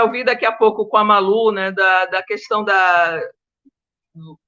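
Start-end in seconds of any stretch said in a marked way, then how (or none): none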